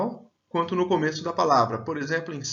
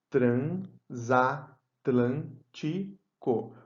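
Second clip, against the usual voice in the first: split into syllables; 2 semitones lower, much slower than usual. Shown as a demonstration. Teacher dividing Transatlântico for students, slow and syllabic. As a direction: stretch Transatlântico out, one syllable at a time. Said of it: "Dá uma olhada".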